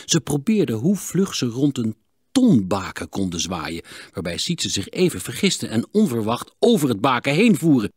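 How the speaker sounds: ordinary delivery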